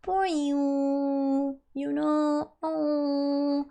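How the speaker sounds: a hint of sugary fake sympathy; mock pity. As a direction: coo oh, poor you with fake pity. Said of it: "I don't want you guys to be like".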